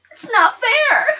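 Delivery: high, loud angry shout; yelled. Acted. Girl storming from the store at Mama, angry yell. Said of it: "I yelled".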